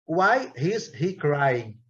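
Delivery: each word drawn out for the class; very slow model question. Shown as a demonstration. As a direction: read it very slowly so they can copy it. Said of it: "fixar o que eu falei". (pergunta bem devagar, palavra por palavra)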